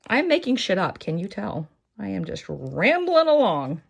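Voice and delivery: usual speaking voice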